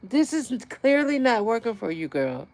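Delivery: a hint of exasperated calling out; mock scolding. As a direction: scold her like a fed-up friend. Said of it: "I need to tell her".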